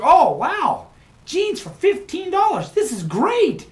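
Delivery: high mock delight over cheap jeans; imitating the excited guy. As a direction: squeal it like the thrilled bargain hunter. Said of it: "He's like".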